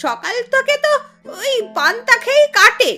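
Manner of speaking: loud bursts of contemptuous scolding; angry, scornful refusal. Acted. Aunt refuses to feed her nephew's playmate, angry and scornful. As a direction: put the poor girl in her place.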